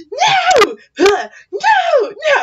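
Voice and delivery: high, loud angry-scared wail; hammed-up tearful protest; imitated crying. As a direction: wail like a furious, frightened child. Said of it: "she's crying".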